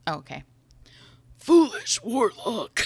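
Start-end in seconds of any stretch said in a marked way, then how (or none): 1.4-2.8 hammed demon voice. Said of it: "Agramon wheezed".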